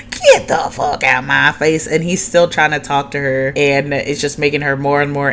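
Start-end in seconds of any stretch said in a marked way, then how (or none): 0.1-1.9 mock angry scream. Fed-up woman repeating her order, mock screamed. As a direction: imitate her screaming, only part-way. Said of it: "while she's screaming at him".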